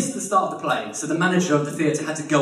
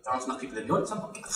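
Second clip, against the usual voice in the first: muttering; 3 semitones lower, 6 dB quieter, much faster than usual. Retold or imitated. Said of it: "he's like".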